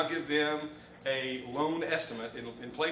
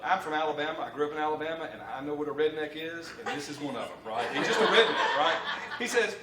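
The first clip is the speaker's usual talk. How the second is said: ordinary talk, nothing marked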